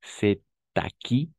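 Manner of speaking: "C'est à qui" slowly, sounding the t into à; linked with liaison. Shown as a demonstration. Teacher showing a student the French link, liaison in "C'est à qui". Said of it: "on va faire une sorte de liaison".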